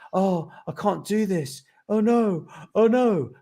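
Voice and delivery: a hammed-up little "oh no" spiral; mock panic. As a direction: play the rising panic of someone about to give up. Inner voice in a moment of panic.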